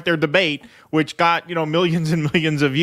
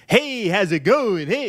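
mock twangy singer; 4 semitones higher, slower than usual. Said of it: "that's not how he talks".

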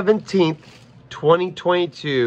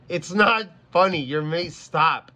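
ordinary talk

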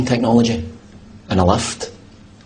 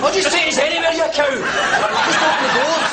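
much higher, exasperated pleading at the lift. Real, not acted. Fed-up man begging the lift to open. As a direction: throw up your hands at the machine.

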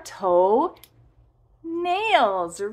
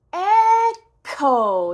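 loud echo; 6 semitones higher, 8 dB louder than usual. Shown as a demonstration. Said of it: "Let's say echo".

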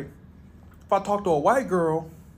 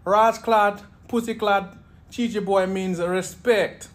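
higher, louder, slow Jamaican patois; mock Jamaican reassurance; 5 semitones higher, 3 dB louder than usual. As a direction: explain it easy in Jamaican patois. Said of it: "He's like".

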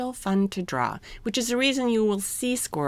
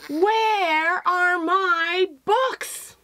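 high, very slow, drawn-out cry; a sad call stretched across the room; wailing refrain; 9 semitones higher, 3 dB louder, much slower than usual. Acted. The book's title refrain voiced.

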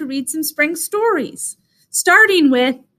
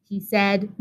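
usual speaking voice

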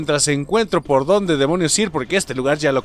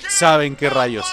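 ordinary talk

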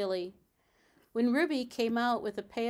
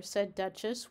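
ordinary delivery

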